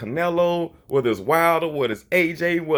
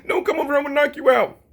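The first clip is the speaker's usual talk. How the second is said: high whiny mock threat; mocking scared voice; 13 semitones higher, 3 dB louder, faster than usual. Mocking how a scared boxer talks, high and whiny. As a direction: whine it like a scared fighter. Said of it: "You see the difference?".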